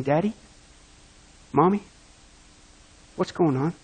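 soft, low, lightly voiced frightened child; hushed, understated child's question; scared child. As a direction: ask quietly like a confused little boy. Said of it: "your son on the table saying".

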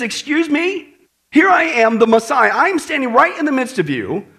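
high, loud mock indignation; indignant. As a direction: protest as if overlooked in plain sight. Acting Jesus confronting the skeptics.

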